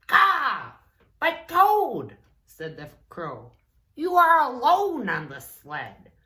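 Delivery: high, loud crow speaking. Acted. Crow answers Toad.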